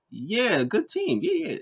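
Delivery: hammed-up high-pitched agreement; imitating Asuka. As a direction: brush it off in a squeaky cheerful voice. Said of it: "Asuka was like".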